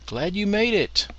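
high, loud, hearty; booming friendly welcome; cheery welcome. Acted. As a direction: greet him like a long-lost pal. Friends greeting O'Brien cheerfully.

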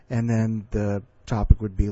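ordinary talk

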